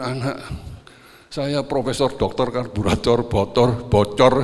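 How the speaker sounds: mock boast. Mocking a son's fancy degree.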